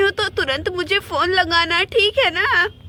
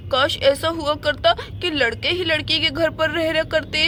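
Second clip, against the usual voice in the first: ordinary delivery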